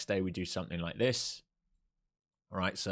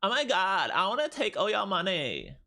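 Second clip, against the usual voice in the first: much higher, louder mock valley girl whine; valley girl impression; 11 semitones higher, 4 dB louder than usual. Streamer voicing his trading tool as a valley girl, high mock whine. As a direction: squeal it like a spoiled LA valley girl. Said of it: "That's what I think N Q sounds like".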